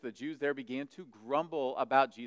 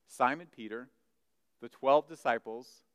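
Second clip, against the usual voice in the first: normal talking voice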